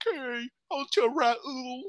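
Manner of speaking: very high put-on voice; exaggerated high-pitched reassurance, stretched at the end; concerned drag queen. Fellow performer offering to cancel the show, high put-on sympathy.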